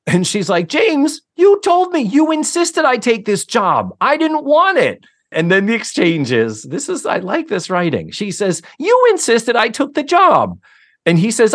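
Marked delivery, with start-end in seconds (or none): 0.7-5.0 imitating the wife, pitched way up in protest; high, indignant wife voice in a quarrel; voiced wife protesting. Wife protests in heated argument. 8.8-10.6 imitating the wife, pitched way up in protest; high, indignant wife voice in a quarrel; voiced wife protesting. Wife repeats her accusation.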